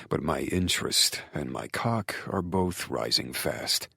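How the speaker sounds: low, quiet man's storytelling voice; male lover narrating. A narrator's reading.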